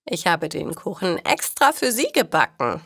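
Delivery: usual speaking voice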